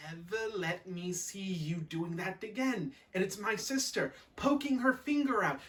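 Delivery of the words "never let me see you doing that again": slow, measured mock scolding; funny voice. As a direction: sister mimicking a telling-off. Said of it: "I hear a funny voice going".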